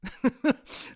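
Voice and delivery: loud burst of laughter; hearty laugh. Acted. Gentleman amused by the boy, laughing loudly. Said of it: "Muž se teď opravdu rozesmál".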